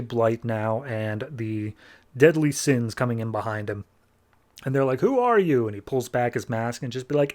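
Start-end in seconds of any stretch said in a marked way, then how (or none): 5.0-5.7 high, alarmed who are you; startled question. Retold or imitated. Family startled at the masked intruder in their wall, alarmed question. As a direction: ask it in sudden alarm, pitched up.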